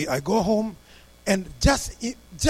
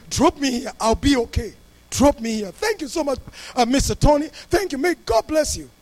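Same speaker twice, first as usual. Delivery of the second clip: retold gratitude; 6 semitones higher, 5 dB louder than usual. Retold passenger thanking the pastor.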